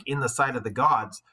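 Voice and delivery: normal talking voice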